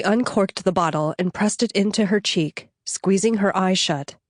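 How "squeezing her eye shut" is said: ordinary delivery, nothing marked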